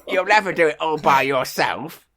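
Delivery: lightly put-on cat voice; Eli the Cat. Cat telling the duck it can't manage, lightly put-on miserable voice. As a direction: scoff in a silly cat character voice. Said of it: "Eli the cat went".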